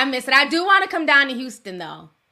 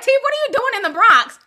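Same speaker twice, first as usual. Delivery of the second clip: higher, louder, amazed stranger voice; surprised passerby; 11 semitones higher, 6 dB louder, faster than usual. Retold or imitated. Bronx people, amazed to spot her. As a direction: call out like a shocked fan. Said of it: "People like".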